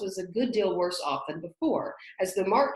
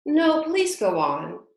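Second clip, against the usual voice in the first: humble; 8 semitones higher, 8 dB louder, slower than usual. A narrator's reading. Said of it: "Alice said very humbly".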